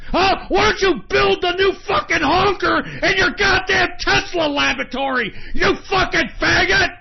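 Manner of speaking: roaring high and loud as if landing a punch; triumphant furious shout. Pretend. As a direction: scream it like you just broke his nose. Acting out punching Elon Musk.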